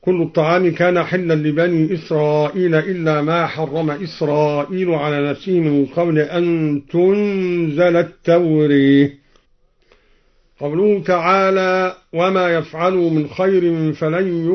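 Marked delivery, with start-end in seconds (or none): 0.0-9.1 التوراة ending in a long e; imala on التوراة. Shown as a demonstration. Teacher reciting al-Duri's example, التوراة with imala. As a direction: tilt the final vowel of التوراة towards e. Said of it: "وأمال التوراة".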